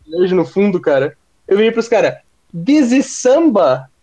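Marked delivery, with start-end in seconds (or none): 2.6-3.9 slow, high fake English accent Portuguese speaker. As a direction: drawl it like a lost tourist. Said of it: "a gente tentando fazer um sotaque inglês".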